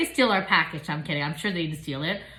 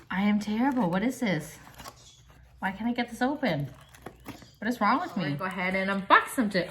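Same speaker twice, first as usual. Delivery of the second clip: normal talking voice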